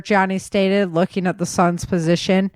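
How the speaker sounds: plain reading voice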